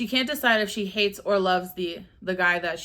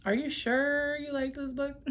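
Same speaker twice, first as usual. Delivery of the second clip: laughing through words; 6 semitones higher, 5 dB quieter than usual. Real, not acted. Repeats question laughing.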